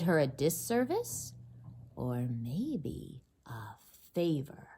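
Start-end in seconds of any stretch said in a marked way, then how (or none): 1.9-4.7 whispered. Acted. Storyteller hushes a question.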